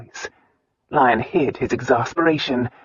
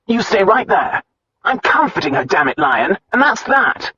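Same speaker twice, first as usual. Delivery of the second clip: loud, higher, stubborn refusal; defiant friend; 6 semitones higher, 3 dB louder than usual. A narrator's reading. Roan refusing Lion, loud and defiant. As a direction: plant your feet and refuse.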